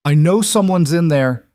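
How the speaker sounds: high, loud yell through a door; shouting. Acted. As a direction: call out hard over the wind. Still yelling at closed door.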